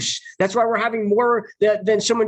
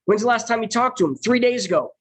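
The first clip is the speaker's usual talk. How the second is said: ordinary talk, nothing marked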